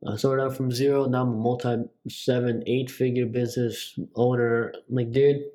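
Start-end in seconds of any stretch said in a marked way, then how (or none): none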